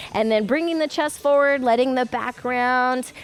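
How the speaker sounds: calm cooldown voice. Acted. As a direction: ease them gently into the stretch.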